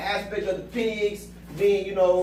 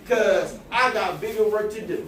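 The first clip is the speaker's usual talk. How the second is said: louder preaching build; 4 dB louder than usual. Real, not acted. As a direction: drive the sermon home. Preaching at full force.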